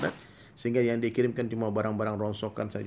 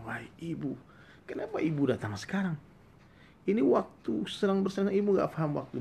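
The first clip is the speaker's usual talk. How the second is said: haughty king; 6 semitones higher than usual. Acted. King brushes off his mother.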